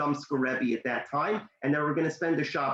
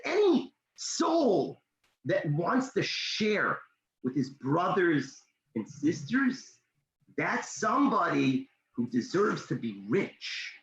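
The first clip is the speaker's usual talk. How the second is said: slow, drawn-out words, each one weighed; solemn blessing; slower than usual. Acted. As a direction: pronounce it like a sacred blessing. Rebbe solemnly blesses the boy.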